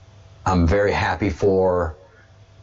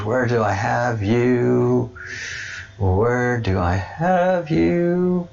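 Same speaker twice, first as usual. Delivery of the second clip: slow searching; 4 semitones higher, much slower than usual. Real, not acted. Searching for page.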